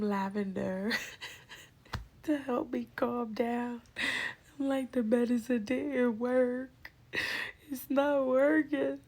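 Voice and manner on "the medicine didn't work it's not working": high, loud, drawn-out mock whine; panicked complaint. Patient on the scan bed complains to staff, panicked wail. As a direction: wail it slowly in comic distress.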